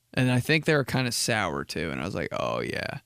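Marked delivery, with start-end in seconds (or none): 2.3-3.0 low sleepy voice. Retold or imitated. Finding the candy.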